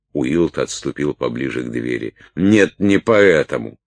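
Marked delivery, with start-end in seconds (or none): 2.3-3.8 scornful correction. A narrator's reading. Салли сама отвечает, распаляясь.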